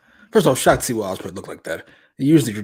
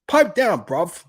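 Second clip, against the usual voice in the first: imitating a British tough guy, louder; mock British growl; 9 semitones higher, 5 dB louder, much slower than usual. Co-host quoting Ospreay's line in mock British accent. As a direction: bark it like a cockney heavy. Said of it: "Like, holy shit".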